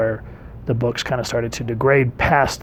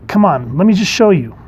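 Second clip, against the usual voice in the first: a hint of an eager kid, higher and louder at the end; lightly voiced pleading kid; 6 semitones higher, 4 dB louder than usual. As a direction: lightly act a kid begging to show off. Kid pleading with parent in comic.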